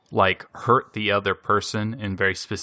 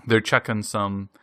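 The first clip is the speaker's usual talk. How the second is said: ordinary delivery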